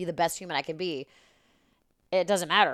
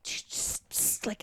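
whisper; 8 dB quieter, much slower than usual. Retold or imitated. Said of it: "I'm like".

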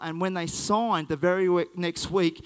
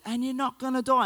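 imitated child's yell; 5 semitones higher than usual. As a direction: retell a boy's yell in a raised pitch. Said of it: "he yells again at the top of his voice".

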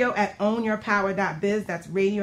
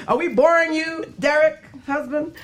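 teasing; 7 semitones higher, 5 dB louder than usual. Real, not acted. Teasing husband reading book.

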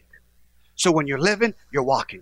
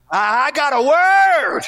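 higher, louder, slowed right down on word; building preacher's cry; 11 semitones higher, 7 dB louder, slower than usual. Acted. Prodigal son crying out his realization. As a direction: stretch out the word like a revelation.

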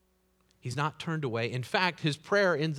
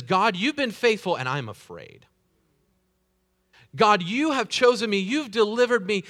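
loud pleading cry to God, higher; pleading prayer; 6 semitones higher, 7 dB louder than usual. Acted. David pleading loudly to God for help. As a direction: voice David begging God for rescue.

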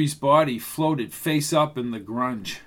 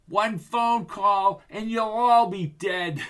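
slow higher threat; menacing villain; 6 semitones higher, slower than usual. Acted. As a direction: threaten slowly and coldly.

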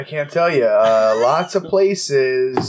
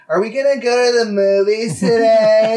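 put-on whiny kid voice; 6 semitones higher, 4 dB louder than usual. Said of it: "be like".

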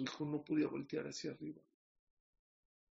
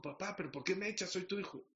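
higher-pitched mock protest; mild acted indignation; 5 semitones higher than usual. Son asks father why he throws stones, mild indignant protest. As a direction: complain to your father, pitched up.

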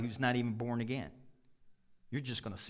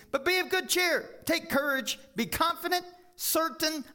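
rousing scripture proclamation; loud preaching; 4 dB louder than usual. Acted. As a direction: proclaim it like good news. Preaching at full force.

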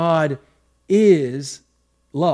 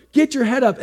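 sharp, higher mock yell at a student; retold teacher yelling; 7 semitones higher than usual. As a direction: bark the order like an angry teacher. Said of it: "he's yelling at me".

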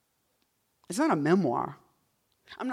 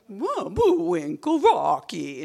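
higher and slow, cartoon Russian woman accent; 8 semitones higher, 7 dB louder, much slower than usual. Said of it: "And she would talk like that".

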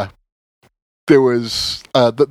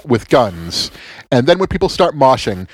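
ordinary talk, nothing marked

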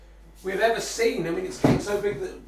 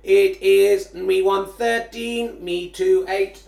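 slow, louder robot voice; computer voice; 9 dB louder, slower than usual. Pretend. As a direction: read the score like a glitchy computer. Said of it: "I have a computer virus".

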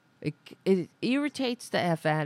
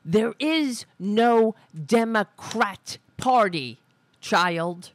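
louder, slow, drawn-out yell aimed past the microphone; scream turned from the mic; 5 dB louder, slower than usual. Real, not acted. Host yelling at the guest on the clip, slow louder shout. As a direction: bellow it slowly, head turned away so the mic doesn't clip. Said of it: "'cause I don't wanna scream into the microphone".

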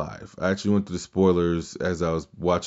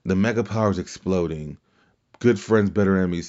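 ordinary delivery

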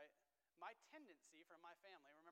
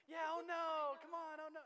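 much higher, much louder teasing oh no; mock little girl; 11 semitones higher, 18 dB louder, slower than usual. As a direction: mimic the toddler while filming her. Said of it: "How empathetic would it be for me to".

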